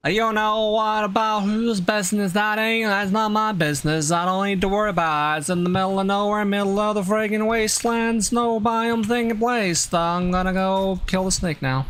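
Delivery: put-on high, twangy made-up North Pole accent. Streamer slipping into a made-up North Pole accent. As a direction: ramble in the silly made-up accent. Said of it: "thinking in that accent".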